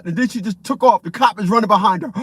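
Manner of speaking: ordinary talk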